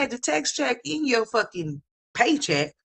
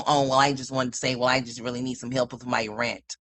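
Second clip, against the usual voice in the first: lower, mocking put-on voice of the tenant; mocking imitation; 10 semitones lower than usual. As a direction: mimic her sugary excuse, pitched low.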